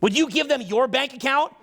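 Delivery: ordinary talk, nothing marked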